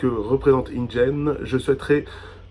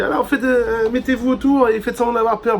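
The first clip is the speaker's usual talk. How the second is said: photographer voice; 9 semitones higher, 3 dB louder than usual. Retold or imitated. Retold photographer's instructions.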